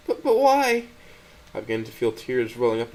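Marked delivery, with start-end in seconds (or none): 0.1-0.8 high, louder tearful plea; a heartbroken cry of why; high, loud, tearful question; Stella crying out. A narrator's reading. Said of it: "as her voice answers".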